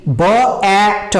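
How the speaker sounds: "ब ए ट" as separate sounds, b-ae-t; sounded out. Shown as a demonstration. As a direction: break "ब ए ट" into three slow phonemes. Teacher sounds out bat slowly. ("ब ए ट" अलग-अलग ध्वनियों में)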